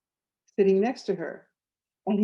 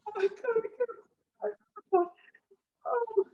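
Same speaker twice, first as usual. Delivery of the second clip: overwhelmed sob. Retold or imitated. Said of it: "she was a mess".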